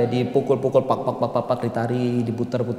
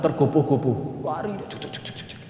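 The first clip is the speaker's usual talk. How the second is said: running sound effect; 5 semitones lower, 9 dB quieter than usual. Retold or imitated. Man running in haste.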